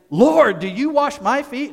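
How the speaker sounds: high, loud startled protest; recoiling objection. Retold or imitated. Peter recoiling as Jesus kneels, startled protest. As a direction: pull back in shock at the Lord. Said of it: "Simon to recoil and say".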